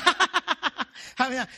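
acted staccato ha-ha-ha; mocking laugh. Atheist jumping out of the bush, hammed-up laugh. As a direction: laugh mockingly in even, punched bursts. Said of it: "The atheist stepped out in the bush and said".

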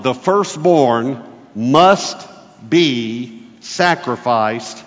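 measured sermon point, weight on "must"; emphatic point one. Shown as a demonstration. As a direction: declare it slowly like a headline. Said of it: "that's what we just read".

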